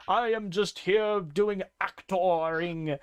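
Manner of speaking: a lightly put-on distinguished English gentleman accent. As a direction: play a bear doing a posh English gentleman accent, a hint of the ham actor. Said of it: "distinguished English gentleman".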